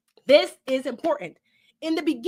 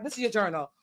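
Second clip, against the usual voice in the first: ordinary talk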